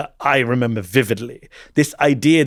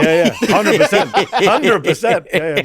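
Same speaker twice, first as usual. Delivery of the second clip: slow, loud laughing yes; a husband laughing in full agreement; 6 semitones higher, 5 dB louder, slower than usual. Real, not acted. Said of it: "laughing agreement".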